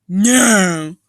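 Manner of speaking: sharp loud no; loud refusal. Acted. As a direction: shut the idea down hard. Character refuses loudly.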